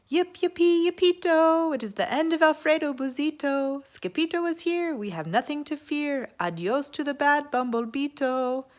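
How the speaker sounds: high, loud yipping celebration; excited cheer. Acted. Scapito cheering that the enemy is finished, high and loud. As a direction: yip it out like a happy little dog. Dog cheers his arrival.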